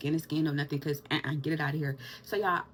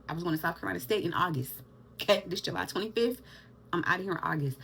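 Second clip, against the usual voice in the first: ordinary talk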